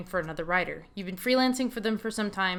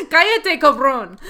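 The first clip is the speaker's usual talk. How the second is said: much higher and louder angry yell; furious Spanish shout; 5 semitones higher, 11 dB louder, slower than usual. Acted. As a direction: bark it at him, fed up. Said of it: "She's pretty pissed off".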